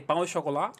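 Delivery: "pan au chocolat" with French sh and final stress; French pronunciation. Retold or imitated. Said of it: "te dicen pan de chocolate". ("pan au chocolat" en francés, sho-ko-LA)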